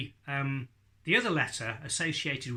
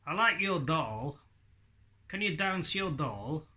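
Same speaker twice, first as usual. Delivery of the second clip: lips still, voice a little higher and slower; "I like your doll" with the "d" made as a buh sound inside the mouth; ventriloquist voice; 3 semitones higher than usual. Shown as a demonstration. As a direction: say it without moving your lips. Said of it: "My lips didn't move".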